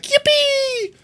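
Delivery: very high squeaky yippee; yippee creature squeal. Retold or imitated. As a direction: squeal it like the meme. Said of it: "And it was like".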